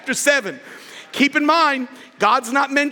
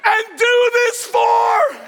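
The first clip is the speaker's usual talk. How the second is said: king's command; 10 semitones higher, much slower than usual. Acted. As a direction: bark the king's orders with a laugh. King orders Haman, laughing.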